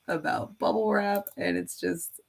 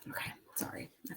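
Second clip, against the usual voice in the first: whisper; 17 dB quieter, much faster than usual. Real, not acted. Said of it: "unvoiced whisper".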